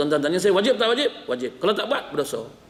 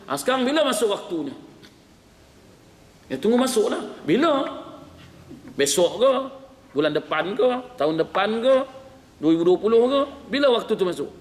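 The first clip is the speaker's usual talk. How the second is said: impatient pressing; 6 semitones higher than usual. Acted. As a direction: push harder with every question. Impatient asker pressing the ustaz.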